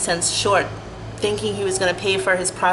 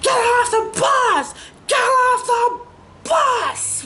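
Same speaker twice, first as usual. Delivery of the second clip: passengers yelling; much slower than usual. Retold or imitated. Passengers yelling at her to leave, imitated shout. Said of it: "started yelling and screaming".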